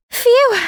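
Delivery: loud, high sigh of relief; relieved phew. Acted. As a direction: blow out relief after a close call. After close call with aunt.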